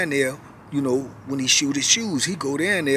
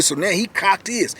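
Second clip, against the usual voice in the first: normal talking voice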